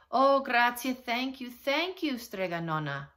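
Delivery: high, loud outpouring of thanks, easing at the end; grateful cry. A narrator's reading. Relieved townspeople to Strega Nonna, crying out gratefully. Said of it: "the people cried".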